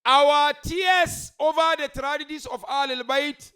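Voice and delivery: slow, high sermon shout; full-force preaching. Real, not acted. Preacher demanding action after tears, slow emphatic shout. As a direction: drive the point home at full volume.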